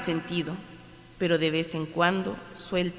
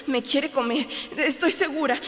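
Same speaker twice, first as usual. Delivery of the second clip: frightened; 6 semitones higher than usual. Acted. Growing panic.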